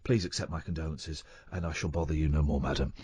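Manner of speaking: soft, low, stammering English apology; imitation of a bumbling, deeply apologetic English gentleman; Hugh Grant. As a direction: apologise profusely in a hushed, halting posh voice. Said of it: "I did my very best Hugh Grant verbally".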